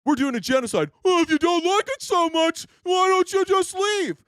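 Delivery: high sneering parody of a troll; mocking the troll. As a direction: parody the commenter as clueless. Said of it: "That's your best response?".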